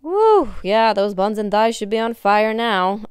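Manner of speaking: loud high whoop, pumped up; aerobics instructor. Real, not acted. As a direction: cheer the class on with energy. Cheering the class mid-workout.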